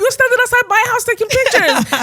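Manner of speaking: fast, high-pitched mimicked yelling; retold shouting tirade, voice way up; imitated furious shouting. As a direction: rant like an enraged homeowner at trespassers. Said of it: "proper shouting in this quiet area".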